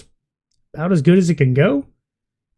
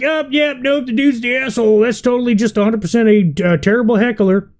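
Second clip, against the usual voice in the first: high, mocking sing-song; mock crowd voice; 5 semitones higher than usual. Commentator mocking crowd members who defended the comedian. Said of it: "was like".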